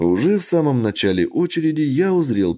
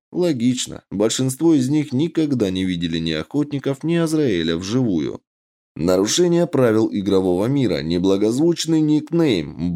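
ordinary delivery, nothing marked

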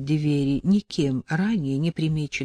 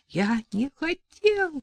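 high, slow, frantic cry; hysterical cry; 10 semitones higher, slower than usual. Acted. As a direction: cry out in a fit of panic. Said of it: "припадочно закричал Леонид Гаврилин".

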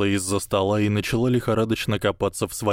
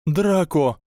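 higher, plaintive call; 8 semitones higher, 4 dB louder than usual. Acted. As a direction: beg for help with his name. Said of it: "жалобно сказала она". (жалобно, с мольбой)

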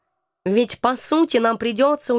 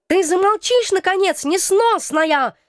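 outburst; 7 semitones higher than usual. Acted. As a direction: blow up at the pest. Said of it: "сорвался кот".

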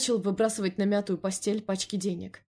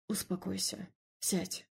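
calming; 8 dB quieter, slower than usual. A narrator's reading. Emil to his pacing wife, calming and quiet. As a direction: quietly settle her down.

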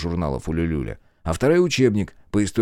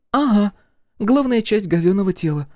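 high, louder deadpan jibe; dark wisecrack; 12 semitones higher, 4 dB louder than usual. A narrator's reading. Girl jokes about the dead villain, dark wisecrack. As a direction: toss in a grim joke. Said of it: "мрачно сострила Олли".

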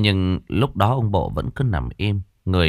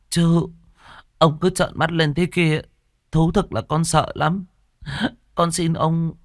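higher, pleading prayer to the dead; fearful murmured prayer; 10 semitones higher than usual. A narrator's reading. Maid pleading with her dead master, fearful higher prayer. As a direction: beg the dead master, frightened. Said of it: "Con Đào lẩm bẩm khấn".

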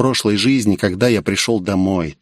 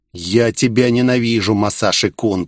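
plain reading voice

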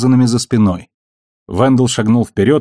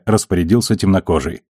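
plain reading voice

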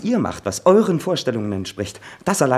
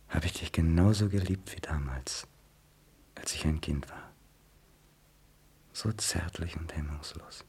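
tender whisper; 5 semitones lower, 9 dB quieter than usual. Acted. Son whispering tenderly to his mother. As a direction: breathe it softly to your mother.

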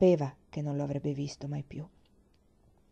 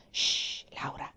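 stern hush; 3 dB quieter, slower than usual. A narrator's reading. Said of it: "le disse papà severamente".

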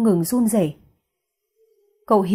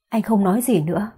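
plain reading voice

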